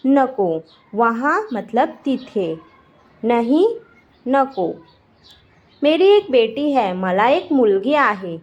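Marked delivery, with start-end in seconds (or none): none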